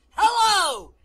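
furious high shout; very loud, enraged bellow of a greeting; violent voice. Acted. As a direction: explode into the phone. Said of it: "a violent voice says".

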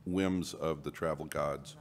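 ordinary talk

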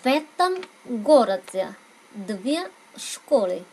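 slow, linked в этом in a teaching read; teacher models the whole phrase slowly; Russian model phrase. Shown as a demonstration. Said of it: "các bạn đọc nối âm giúp mình".

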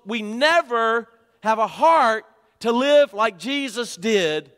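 high, loud, urgent sermon challenge; full-force preaching. Acted. Preacher challenging his church on never reaching people, full-force preaching.

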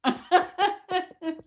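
laughing it off after an apology; soft real laugh. Real, not acted. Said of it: "I'm sorry".

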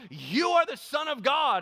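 high, loud, drawn-out proclamation; crying out. A narrator's reading. Unclean spirits falling down before Jesus, crying out. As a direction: cry it out slowly at the top of the range. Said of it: "they cried out".

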